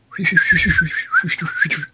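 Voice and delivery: a pathetic, high imitation of droid beeping; a feeble attempt at astromech chirps; R two beeps. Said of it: "that was my pathetic R two".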